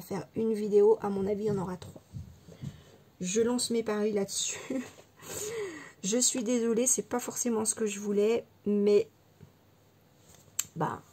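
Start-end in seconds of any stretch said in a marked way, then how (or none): 5.2-6.0 short high embarrassed giggle. Real, not acted. Crafter giggling to viewers about her three videos. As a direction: giggle at your own admission. Laugh at her own bet.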